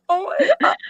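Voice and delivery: high, loud gasp of shock; retold startled exclamation; shocked oh my God. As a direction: blurt it out in disbelief as a kid. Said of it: "I was like".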